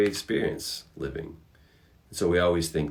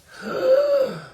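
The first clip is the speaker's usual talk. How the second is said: dramatic gasp; 5 dB louder than usual. Acted. Dramatic gasp before the point.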